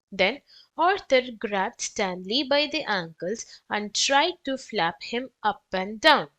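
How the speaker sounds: ordinary delivery, nothing marked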